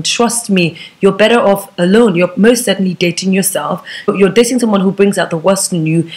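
usual speaking voice

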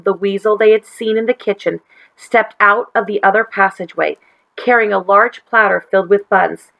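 usual speaking voice

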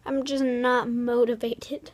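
ordinary talk, nothing marked

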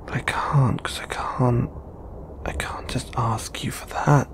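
soft, near-whispered admission to yourself; hushed confession. Acted. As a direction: confess it under your breath. Secretly confessing longing to self.

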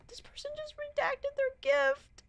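shocked high whisper. Real, not acted. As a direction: whisper it in disbelief.

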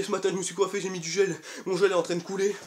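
usual speaking voice

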